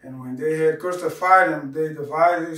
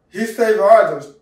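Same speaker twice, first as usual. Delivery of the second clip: loud, raised jeer from the priests; mocking; 4 semitones higher, 10 dB louder than usual. A narrator's reading. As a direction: throw it out loudly in mockery. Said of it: "were mocking, saying".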